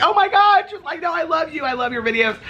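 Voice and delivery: high, excited squeal of a fan; gushing fan. Retold or imitated. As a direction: imitate a starstruck fan, gushing. Fan gushing over speaker.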